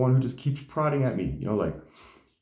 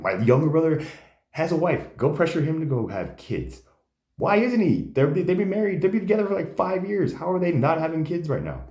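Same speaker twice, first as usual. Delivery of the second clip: indignant protest; 8 semitones higher than usual. Retold or imitated. Indignant at family pressure.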